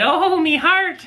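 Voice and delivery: high, drawn-out mock Newfoundland accent. Husband teasing wife about her reaction, exaggerated Newfoundland accent. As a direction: imitate a swooning Newfoundlander, high and slow. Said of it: "in her Newfoundland accent".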